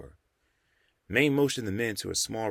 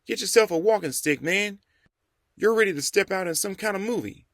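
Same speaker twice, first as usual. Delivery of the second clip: put-on higher, louder young man's voice teasing an old friend; ribbing the dapper old man, grinning; warm teasing greeting; 11 semitones higher, 4 dB louder than usual. A narrator's reading. Young Jamal greets the dressed-up Rev warmly, high and loud. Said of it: "greeting the old man warmly".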